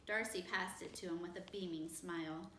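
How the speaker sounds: plain reading voice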